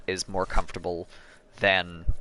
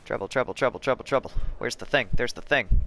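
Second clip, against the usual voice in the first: normal talking voice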